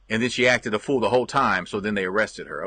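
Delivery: usual speaking voice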